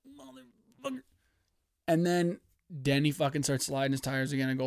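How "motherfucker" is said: high, much softer curse; tense curse. Retold or imitated. Sweating fan at the restart, soft high curse. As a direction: breathe the curse under pressure. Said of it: "I'm like".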